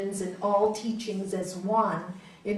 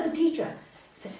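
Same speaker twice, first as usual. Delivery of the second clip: usual speaking voice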